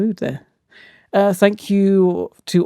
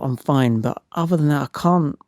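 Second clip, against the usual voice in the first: usual speaking voice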